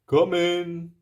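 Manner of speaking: a jolly giant's booming welcome. Acted. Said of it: "boomed the giant".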